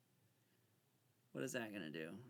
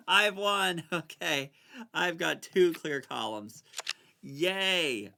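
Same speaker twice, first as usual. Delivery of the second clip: much louder, happy celebration; excited; 4 semitones higher, 12 dB louder, slower than usual. Real, not acted. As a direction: crow over the victory. Celebrating the win.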